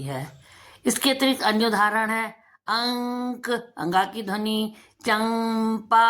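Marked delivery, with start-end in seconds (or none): 5.0-6.0 चंपा said very slowly with a held m; nasal m demo. Shown as a demonstration. Teacher to children, drawing out चंपा slowly. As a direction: stretch चंपा so the माँ sound rings. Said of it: "माँ की ध्वनि".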